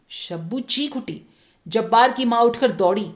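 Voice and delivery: plain reading voice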